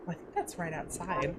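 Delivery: normal talking voice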